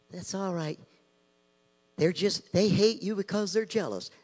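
a light hint of a soothing celebrity preacher; mocking smooth preacher. Preacher mocks leading voices, lightly voiced soothing tone. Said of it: "from these leading voices".

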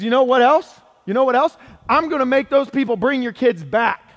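loud, high-pitched fired-up declaration; acted forceful vow. God promising his people their kids back, loud and fired-up. Voices God vowing fierce rescue.

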